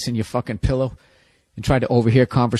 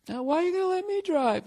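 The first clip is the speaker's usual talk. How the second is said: put-on high girlfriend voice; 4 dB quieter than usual. Girlfriend voiced in breakup bit.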